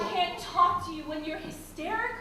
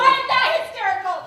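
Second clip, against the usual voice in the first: indignant; 3 semitones higher, 8 dB louder than usual. Acted. Wife denies hysteria loudly.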